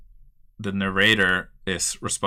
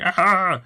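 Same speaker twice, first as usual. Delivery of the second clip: high, loud mock yelp of pain; pained cry; 11 semitones higher, 8 dB louder than usual. Punched man falling backwards, high mock yelp. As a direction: yelp as the fist lands. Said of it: "and is like".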